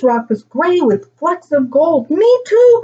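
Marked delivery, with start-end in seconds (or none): 2.1-2.8 put-on high, loud excited child's cry. A narrator's reading. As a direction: cry out eagerly, voice pitched way up. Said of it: "cried out Emma".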